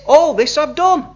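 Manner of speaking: self-righteous claim. Retold or imitated. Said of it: "He thinks he's righteous".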